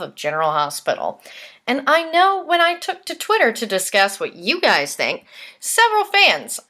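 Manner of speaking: lively, presentational host delivery; punchy scripted read with emphatic key words; scripted podcast news read. Real, not acted. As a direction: read it out like a soap news segment.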